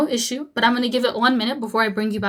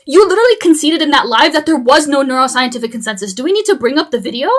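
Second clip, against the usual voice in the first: heated; 3 semitones higher, 10 dB louder than usual. Real, not acted. Heated debate accusation.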